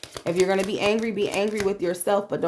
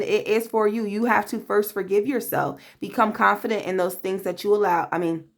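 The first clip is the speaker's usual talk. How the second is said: ordinary talk, nothing marked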